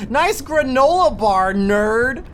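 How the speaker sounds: slow, loud playful jeer; loud mock teasing. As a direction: jeer at a friend for snacking. Teasing friend loudly.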